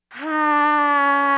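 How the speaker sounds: big loud open-mouthed sigh; dramatic sigh. Shown as a demonstration. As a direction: let out a huge audible sigh. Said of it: "release a big sigh".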